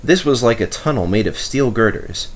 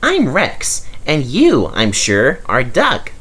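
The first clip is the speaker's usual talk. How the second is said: small engine Rex voice; 6 semitones higher than usual. A narrator's reading. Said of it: "smiled the small engine".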